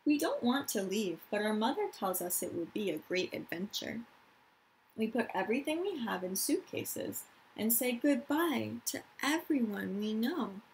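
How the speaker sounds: calm storytime reading; reading to children. Acted. Teacher reading a picture book to her class, gentle storytime.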